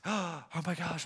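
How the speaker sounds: soft, gasped amazement; imitated gasp. Worker hearing her feelings named, imitated with a soft gasp. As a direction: gasp it under your breath, as if overcome. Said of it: "like, gasped".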